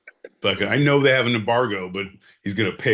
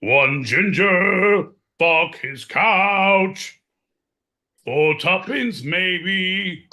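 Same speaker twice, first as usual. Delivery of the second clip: high, loud, slow street hawker; market crier; 11 semitones higher, 5 dB louder, much slower than usual. Acted. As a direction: cry the wares across a Victorian market. Crier hawking again.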